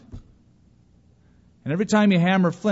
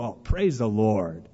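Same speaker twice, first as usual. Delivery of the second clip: lower, slow hushed praise; lightly voiced onlooker praising God; a touch of reverent praise; 3 semitones lower, slower than usual. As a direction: murmur it as if moved. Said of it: "people see it and go".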